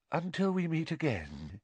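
soft, high, wistful farewell; hushed mystic goodbye; faint. A narrator's reading. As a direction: let the words float away faintly. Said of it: "said Professor Trelawney faintly".

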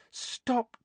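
slow, high, rattled plea; drawn-out, high-voiced complaint; weak protest. A narrator's reading. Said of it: "Hermione said weakly".

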